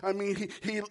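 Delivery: normal talking voice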